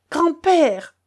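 put-on frightened boy crying out to his grandfather. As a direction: call over the thunder. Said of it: "cria Lanka".

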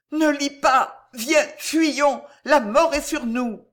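high, loud terrified plea; panicked cry, pitched far up; horror and dread. A narrator's reading. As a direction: beg him to flee for his life. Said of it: "toute l'épouvante étaient dans la voix d'Alice".

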